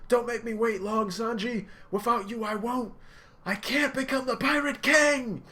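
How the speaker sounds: high, loud, building; impassioned shouting. Retold or imitated. Luffy shouting after Sanji's carriage. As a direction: pour everything into the cry.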